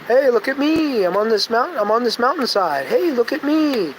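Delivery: high-pitched mock boast; mocking show-off. Mocking a show-off bragging from the mountainside, high mock boast.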